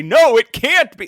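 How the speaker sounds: loud, high-pitched cry of disbelief; incredulous mock outcry. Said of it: "look at her and say".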